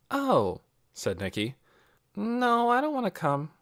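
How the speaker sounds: lightly voiced girl, puzzled; a touch of a curious girl; girl's voice. A narrator's reading. Nikki voiced in story.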